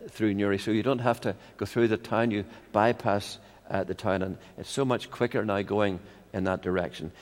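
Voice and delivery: normal talking voice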